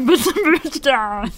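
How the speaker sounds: high mocking whiny echo. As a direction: parrot it back in a silly voice. Mocking repeat of friend.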